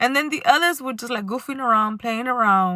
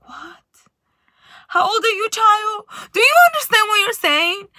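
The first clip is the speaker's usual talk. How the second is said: higher, louder shocked scolding; 9 semitones higher, 7 dB louder than usual. Retold or imitated. Scolding a rude six-year-old at the park, shocked and loud. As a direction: recoil in disbelief at the child.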